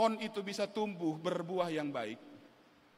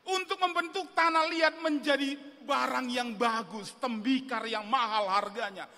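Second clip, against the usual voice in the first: normal talking voice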